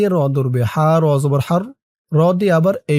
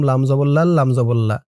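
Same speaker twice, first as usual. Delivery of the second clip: normal talking voice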